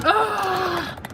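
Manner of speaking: frustrated shout-groan; angry frustrated groan. Real, not acted. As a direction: let out a furious ugh. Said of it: "I am so angry".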